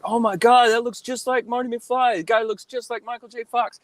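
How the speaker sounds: high-pitched gushing fan imitation; mock-astonished squeal of recognition; amazed fans. Imitates amazed people seeing him.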